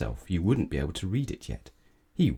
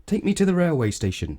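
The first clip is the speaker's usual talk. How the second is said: higher, louder demanding passenger; haughty cry; 9 semitones higher, 5 dB louder than usual. Acted. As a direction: bark the order at the driver. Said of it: "cried Mister Uppity".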